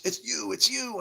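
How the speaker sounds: high-pitched insistent accusation; accusing lady. Retold or imitated. Lady in his face insisting it's him. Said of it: "she got in my face".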